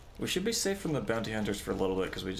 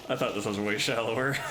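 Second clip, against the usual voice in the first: ordinary talk, nothing marked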